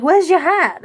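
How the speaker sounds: loud, high imitation of a worried boxer; hammed-up anxious question; Rocky, concerned. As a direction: blurt it out with mock concern. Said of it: "He's, like, so concerned".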